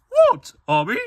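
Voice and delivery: put-on loud falsetto girl question; Yumi. Yumi checking on staring Ami, squeaky and loud. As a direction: yelp it in a high girl voice.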